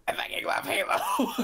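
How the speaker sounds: cracked, very high strained shout; drill instructor imitation. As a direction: screech it like an overexcited drill instructor. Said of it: "sounded different. He was, he was like".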